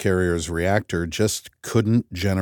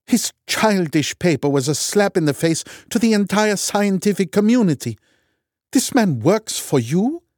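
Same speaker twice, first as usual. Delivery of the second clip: raised, high contempt; scornful, indignant; 9 semitones higher, 3 dB louder than usual. A narrator's reading. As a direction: sneer at his work loudly. Loken's scornful attack.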